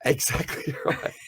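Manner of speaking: breathy laugh through the words. Real, not acted. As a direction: laugh while agreeing. Laughing at listener's bank robbery joke.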